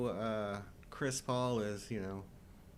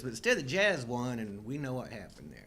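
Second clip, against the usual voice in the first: ordinary talk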